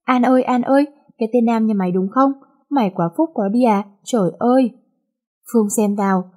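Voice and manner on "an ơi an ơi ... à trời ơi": ordinary delivery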